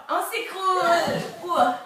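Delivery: crié en riant, plus aigu, plus fort; s'effondrer en éclatant de rire; rire en s'écroulant. Real, not acted. Enfants s'écroulent en jouant. (on s'écroule en riant, bien fort)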